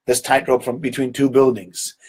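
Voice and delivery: usual speaking voice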